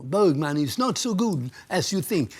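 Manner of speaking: put-on slow Swedish-accented English jab. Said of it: "sa han".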